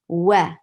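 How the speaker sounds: Wa from circled lips, not teeth; rounded lips. Shown as a demonstration. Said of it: "Always use your circled lips".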